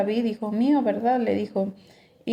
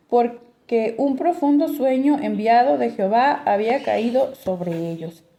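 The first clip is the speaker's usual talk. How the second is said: raised, louder Bible reading voice; scripture reading; 3 semitones higher, 3 dB louder than usual. Acted. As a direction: read the passage out to the congregation. Reads scripture passage aloud. (lectura bíblica en voz alta)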